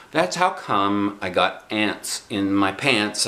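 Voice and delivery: ordinary delivery, nothing marked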